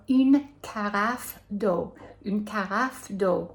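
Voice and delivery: "une carafe d'eau" said slowly in French, une with a rounded u; slow French demo of "une carafe d'eau". Shown as a demonstration. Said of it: "you should ask for".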